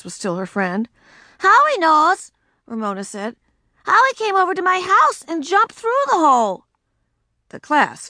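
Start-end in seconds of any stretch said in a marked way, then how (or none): 1.4-2.3 put-on eager girl voice. Ramona appealing. 3.8-6.6 put-on eager girl voice. Ramona explaining.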